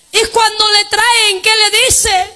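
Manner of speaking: high, ringing pulpit cry; preaching at full force. Acted. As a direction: preach it out to the congregation. (predicando a todo pulmón)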